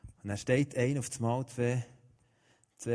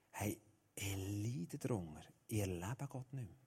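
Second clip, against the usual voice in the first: quiet confession; 6 semitones lower, 11 dB quieter than usual. Retold or imitated. Young man quietly confesses to the preacher that he suffers. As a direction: confide the pain quietly.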